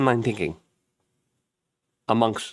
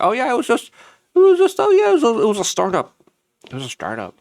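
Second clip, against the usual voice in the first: much higher, fumbling cover-up voice; mock flustered propaganda; 12 semitones higher, 4 dB louder, faster than usual. Propaganda pieces explaining drones away, high flustered fumbling. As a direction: squeak out a flimsy excuse. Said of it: "propaganda pieces out there being like".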